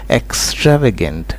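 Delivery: "extravagant" very slowly, stress on TRAV; careful pronunciation. Shown as a demonstration. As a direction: draw out "extravagant" syllable by syllable as a model. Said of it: "careful रहना है जब इस word को pronounce". ("extravagant" का बहुत धीमा, साफ़ उच्चारण)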